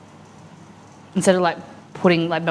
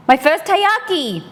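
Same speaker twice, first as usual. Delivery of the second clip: excited; 7 semitones higher, 4 dB louder, slower than usual. Real, not acted. Excited reveal of first taiyaki.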